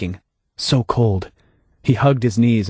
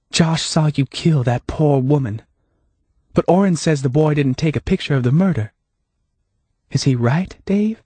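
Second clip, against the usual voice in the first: higher, lightly voiced Hannah; Hannah, calm and knowing; 6 semitones higher than usual.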